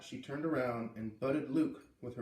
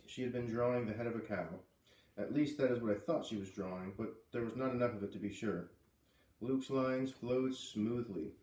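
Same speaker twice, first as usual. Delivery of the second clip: ordinary delivery, nothing marked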